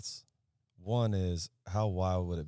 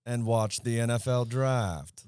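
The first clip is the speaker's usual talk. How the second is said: newscaster voice; 5 semitones higher, 8 dB louder than usual. Retold or imitated. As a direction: ham up a TV newsreader reading his own evening plans. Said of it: "do you think newscasters always talk like that?".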